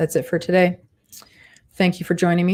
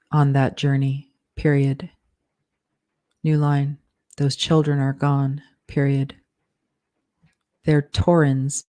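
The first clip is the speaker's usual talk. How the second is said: steady dictation with spoken punctuation; dictating; 2 semitones lower than usual. Acted. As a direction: read it out like dictating a draft. Author dictates novel with punctuation.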